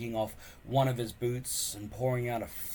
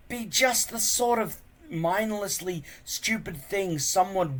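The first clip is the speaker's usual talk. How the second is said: worried Hiccup; 8 semitones higher, 6 dB louder than usual. Acted. Hiccup worrying.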